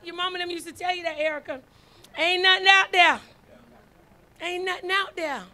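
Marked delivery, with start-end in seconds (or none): 2.2-3.2 loud, punched warning; emphatic warning. Acted. Preacher echoing mama's warning to Erica, loud and emphatic. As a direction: proclaim it like mama did.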